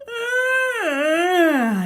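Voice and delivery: long, high, loud yawn; tired hare's big yawn. A narrator's reading. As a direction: yawn it out, exhausted. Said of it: "yawned loudly".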